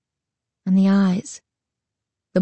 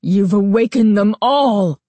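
crying out; 4 dB louder than usual. A narrator's reading. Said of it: "he cried".

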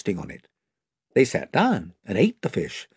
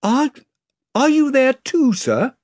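put-on higher, halting boy's voice; Edmund hopeful; 12 semitones higher, 4 dB louder than usual. A narrator's reading. Edmund hopefully asks Aslan, higher and halting. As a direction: ask timidly with hope.

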